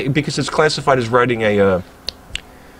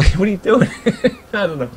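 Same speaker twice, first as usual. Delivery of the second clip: laughing; 8 semitones higher than usual. Real, not acted. Laughing at engine kits.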